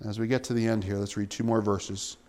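ordinary delivery

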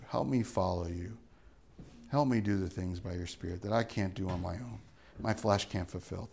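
hushed pastoral closing prayer. Acted. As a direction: pray quietly and earnestly.

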